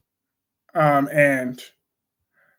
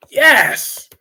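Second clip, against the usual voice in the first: emphatic yes; 9 semitones higher, 5 dB louder than usual. Real, not acted. Agreeing with chat on accent.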